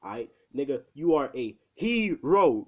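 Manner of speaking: HERO drawn out high and loud; exaggerated emphasis. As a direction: hammer HERO with a big high swoop. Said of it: "Keeps putting, like, the emphasis".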